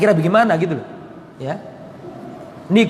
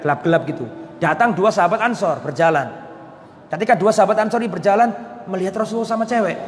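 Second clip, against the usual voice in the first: usual speaking voice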